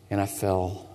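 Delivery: drowsy trailing off as sleep takes over; fading into sleep. Acted. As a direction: voice sinking very soft and low. Shepherd drifting off to sleep.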